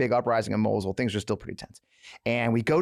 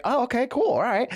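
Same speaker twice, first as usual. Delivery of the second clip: high, quick "okay, cool"; mock eager agreement; 11 semitones higher than usual. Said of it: "He's like".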